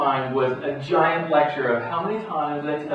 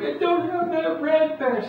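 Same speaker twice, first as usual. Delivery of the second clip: much higher, slow, tearful mock child wail; imitating daughter in tears; 4 dB louder, slower than usual. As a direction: play the stressed daughter about to cry. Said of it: "She burst into tears".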